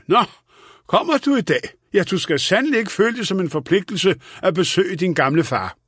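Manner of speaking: put-on high-pitched, mildly indignant old father; vredt. A narrator's reading. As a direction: reproach a neglectful son. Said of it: "vendte sig vredt mod sin søn". (let fornærmet og bebrejdende)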